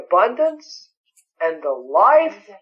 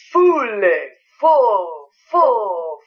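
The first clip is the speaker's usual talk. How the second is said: Fülle then full, full said higher and louder; hammering full; 5 semitones higher, 3 dB louder, much slower than usual. Acted. Preacher hammers the word full.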